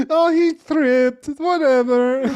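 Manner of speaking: squeaky granny, casually dismissive; Doctor Ruth. Retold or imitated. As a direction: play it as an innocent tiny old woman shrugging it off. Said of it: "fucking Doctor Ruth's like".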